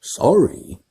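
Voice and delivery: loud, high barked word. Acted. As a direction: bark it in disbelief. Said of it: "barked Hagrid".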